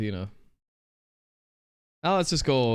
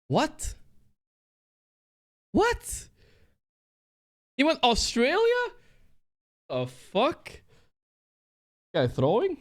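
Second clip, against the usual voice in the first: high, rising disbelief; incredulous; slower than usual. Real, not acted. Gamer stunned by his opponent's Australia guess, incredulous. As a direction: squeak it out in baffled shock.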